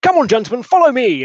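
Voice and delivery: high, loud summons to follow; rousing call. Acted. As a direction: stride off and call them along. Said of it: "And he's like".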